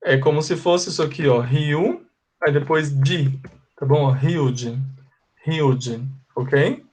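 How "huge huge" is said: "huge" with a breathy h, like hiudge; model pronunciation. Shown as a demonstration. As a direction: say "huge" with the h sounding like a Portuguese R. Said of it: "esse H tem som de R". ("huge" com o H soando como R)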